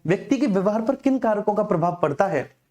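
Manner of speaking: ordinary talk, nothing marked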